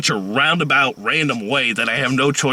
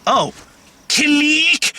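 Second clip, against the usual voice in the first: high put-on voice, mocking; imitating the ex-fiance in a squeaky tone; 3 dB louder, much slower than usual. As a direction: mimic her in a much higher voice. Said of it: "Amanda at the time was like".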